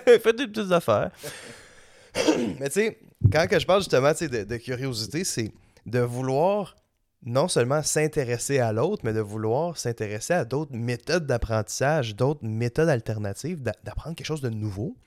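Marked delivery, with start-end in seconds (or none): none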